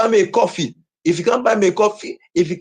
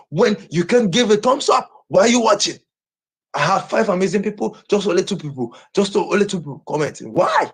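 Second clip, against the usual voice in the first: ordinary delivery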